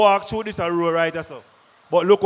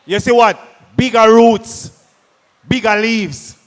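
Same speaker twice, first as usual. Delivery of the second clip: loud, drawn-out call of the answer; 8 dB louder, slower than usual. Real, not acted. Presenter to farmers at a field talk, slow loud shout. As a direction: belt the answer so the back row hears.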